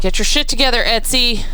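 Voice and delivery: angry. Real, not acted. Angry call-out to Etsy.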